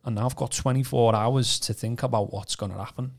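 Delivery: ordinary talk, nothing marked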